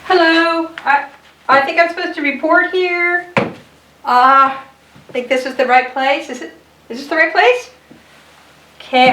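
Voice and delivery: a lightly voiced timid crayon checking if it's the right room; nervous crayon.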